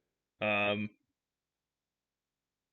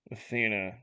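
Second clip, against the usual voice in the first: ordinary delivery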